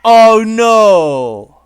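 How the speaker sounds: loud, high cry of dismay; real dismayed shout. Real, not acted. Streamer seeing the worst ending, crying out loud. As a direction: yell it as the bad ending hits.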